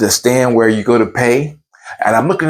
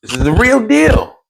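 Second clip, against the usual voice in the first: high teasing wife voice; high-pitched wife laying down the deal; 11 semitones higher than usual. Retold or imitated. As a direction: push up high and playful as the wife. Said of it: "It's like".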